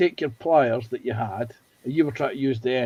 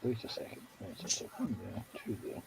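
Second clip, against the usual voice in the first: muttering; 5 semitones lower, 21 dB quieter than usual. Real, not acted. Muttering while counting pieces.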